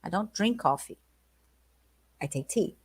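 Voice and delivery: soft, genteel quote; a light touch of English gentility; posh Englishman. Retold or imitated. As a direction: murmur it like a refined Englishman. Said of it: "De como".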